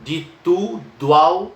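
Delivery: demonstrating the elision; elision shown. Shown as a demonstration. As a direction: glide "tudo ao" together as one beat. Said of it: "é uma sílaba só".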